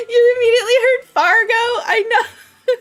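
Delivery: loud, high, fast through laughter; delighted, voice pushed up by laughing; laughing hard. Real, not acted. Streamer agreeing with a viewer about Fargo, laughing. Cracking up at chat's comment.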